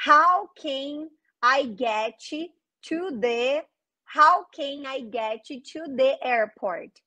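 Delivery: very slow, deliberate model question; slow careful model. Shown as a demonstration. Teacher slowly modelling the whole question for students. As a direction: spell it out slowly for the class. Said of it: "Eu falei airport certinho".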